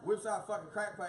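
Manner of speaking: ordinary talk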